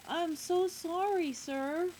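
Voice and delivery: put-on high, slow, loud child's apology; apologetic little boy. A narrator's reading. Little boy to the man he bumped, apologetic. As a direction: apologize in a small boy's voice. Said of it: "The little boy says".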